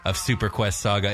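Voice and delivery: ordinary talk, nothing marked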